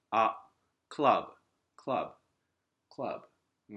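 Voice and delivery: クラブ as "club", short, strong "ah". Shown as a demonstration. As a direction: punch the あ in クラブ briefly. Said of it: "短く強いあ". (「クラブ」の「あ」を短く強く)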